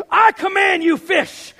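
high, loud faith-healer style shout; yelled command. Retold or imitated. Fisherman commanding fish in Jesus' name, yelled. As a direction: bellow it as a command, slowing on the last words. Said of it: "Sorry, I yelled again".

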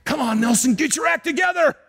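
mock telling-off, pushed up high; imagined scolding. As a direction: scold yourself as a fed-up God would. Acted scolding from God, imagined.

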